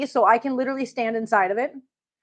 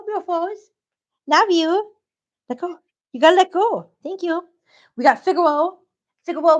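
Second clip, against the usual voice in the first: high sing-song cooing; 5 semitones higher than usual. Real, not acted. Owner greeting her cats, in high baby talk.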